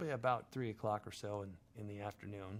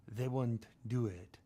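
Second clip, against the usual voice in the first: put-on slow, heavy Chechen accent with freak said as frig; slow Chechen gangster accent. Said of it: "I loved his accent".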